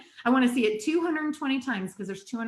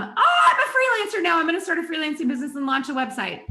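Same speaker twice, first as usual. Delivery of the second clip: much higher and louder, laughing, fast on the second line; mock eager newbie; 6 semitones higher, 5 dB louder than usual. Coach mocking her own past self as a giddy freelancer, high laughing voice. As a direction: squeal like a giddy new freelancer sure clients will flock in.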